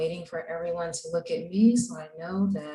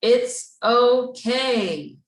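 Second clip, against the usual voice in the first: "It's okay" slow, higher and louder with conviction; said like you mean it; 5 semitones higher, 6 dB louder, much slower than usual. Shown as a demonstration. Teacher shows the class how to mean it, loud and slow. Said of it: "say it like you mean it".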